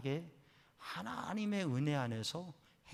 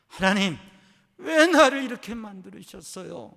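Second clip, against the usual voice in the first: loud, much higher cry to God; wailing prayer; 6 semitones higher, 16 dB louder than usual. Retold or imitated. Daughter wailing her prayer to God, loud. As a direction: cry out to heaven. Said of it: "울부짖으면서 기도하는데".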